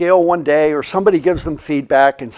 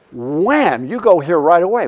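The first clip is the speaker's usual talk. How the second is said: ordinary talk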